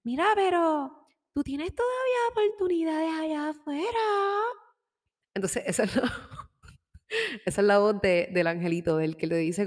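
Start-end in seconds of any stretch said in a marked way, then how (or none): 0.0-4.0 much higher sweet voice urging you on; encouraging little angel voice. Acted. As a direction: play the little angel cheering you forward. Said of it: "la vocecita que te impulsa hacia adelante". (vocecita de angelito, muy aguda y alentadora)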